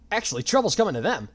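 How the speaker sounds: bright, high cheerful call; cheery. A narrator's reading. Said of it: "a cheery voice called down".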